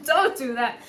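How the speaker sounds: DON'T DO THAT through a laugh; laughing plea. Real, not acted. Urging Leo not to accept abuse, laughing plea. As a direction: repeat the plea, breaking into loud laughter.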